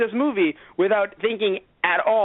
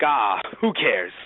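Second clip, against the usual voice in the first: slow dismissive drawl; 7 semitones lower, much slower than usual. Retold or imitated.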